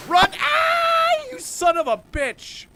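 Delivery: high, loud furious yell; real furious shout as the ship is attacked. Real, not acted. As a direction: scream it at the attacker.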